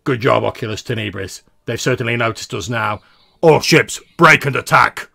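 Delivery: rally the fleet, ending in a battle shout; gruff commander. Acted. Fleet command to allied pilots over the comm, gruff, rising to a shout. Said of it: "you hear a gruff voice say".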